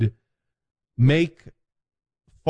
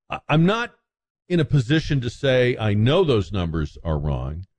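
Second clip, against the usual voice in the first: ordinary delivery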